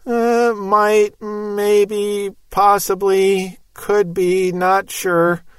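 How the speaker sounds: drawn-out, wavering hedge on one held note; mock uncertainty, slow and noncommittal; wishy-washy. Preacher parodying a feeble promise from Christ, wishy-washy. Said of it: "that's wishy-washy in the extreme".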